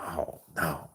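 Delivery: very soft, low groan of pity; hushed dismay. Retold or imitated. Seeing this man made to cry, hushed dismay. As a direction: let it drop low and quiet in dismay.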